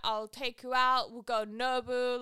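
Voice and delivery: loud, slow put-on British lad; drawn-out UK lad impression; very UK accent. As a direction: swagger it out in a mock Manchester accent. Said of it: "it's just so U K".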